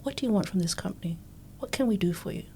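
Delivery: hushed whisper, a bit quick; a boss's hushed question to a rookie; quoted whisper. Retold or imitated. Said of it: "he looked at me and went".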